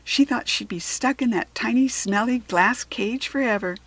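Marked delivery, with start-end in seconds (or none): none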